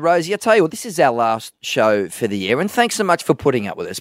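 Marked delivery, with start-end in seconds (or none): none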